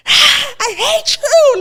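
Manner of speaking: high, loud mock shriek; retold high-pitched outburst; imitated scream. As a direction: scream it out like her meltdown, hammed-up. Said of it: "Like that, like that".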